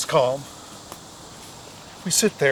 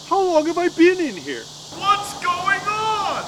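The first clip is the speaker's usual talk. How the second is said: rising panic; 12 semitones higher, slower than usual. Retold or imitated. Panicking in the hot tent.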